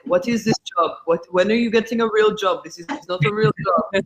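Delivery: put-on a light Israeli accent, dad scolding. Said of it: "forgive my terrible Israeli accent".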